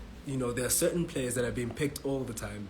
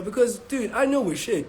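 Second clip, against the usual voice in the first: frustrated; 8 semitones higher, 6 dB louder than usual. Real, not acted. Frustrated rant about the team.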